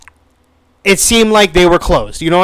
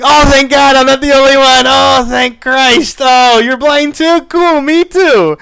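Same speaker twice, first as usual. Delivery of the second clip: relieved; 9 semitones higher, 3 dB louder than usual. Retold or imitated. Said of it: "so I'm like".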